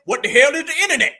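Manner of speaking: mock indignant confusion. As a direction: scoff at the word internet. Mocking politician in denial.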